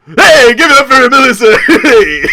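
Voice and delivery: loud, high hype-man shout; cheering. Acted. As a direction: whoop up applause for a friend. Cheering after Melissa's speech.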